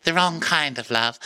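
a high, dreamy retelling of a sad backstory; hammed-up high voice, full of drama; high dramatic mock voice. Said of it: "she's like".

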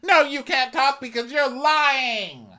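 very high, loud cut-off; Jenny shutting Mary down, very high; shrill mock retort. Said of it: "she goes".

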